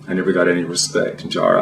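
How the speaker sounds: ordinary talk, nothing marked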